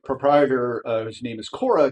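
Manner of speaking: ordinary delivery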